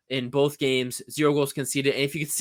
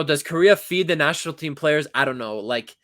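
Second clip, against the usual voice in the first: usual speaking voice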